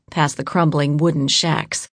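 usual speaking voice

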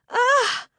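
very high, loud gasp of pain. A narrator's reading. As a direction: yelp as the hand takes the fall. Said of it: "I gasped".

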